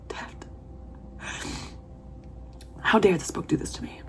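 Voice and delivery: whispered with a sigh; sighed whisper at the book. Real, not acted. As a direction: breathe it out, undone. Emotional whisper.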